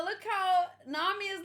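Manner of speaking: usual speaking voice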